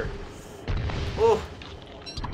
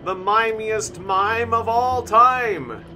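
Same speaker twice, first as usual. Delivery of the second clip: higher, slow hype introduction; ring announcer; 6 semitones higher, 3 dB louder, much slower than usual. Pretend. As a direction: announce the fighter like a ringside MC. Mock boxing announcer introduction.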